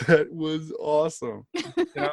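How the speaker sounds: lightly voiced gleeful god, drawn out; impressed god. Imagined gods react to someone messing with a god, mock awe.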